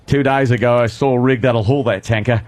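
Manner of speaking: put-on Australian accent. Host quoting Road Warrior to show his Australian accent. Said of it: "get into that mindset".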